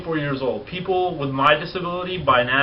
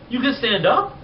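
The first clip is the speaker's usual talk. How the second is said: amazed question; 8 semitones higher than usual. Retold or imitated. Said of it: "They're like".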